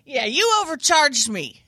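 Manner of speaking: high, loud, drawn-out accusation; mock indignant complaint. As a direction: complain slowly with play-acted outrage. Acted complaint to the manager.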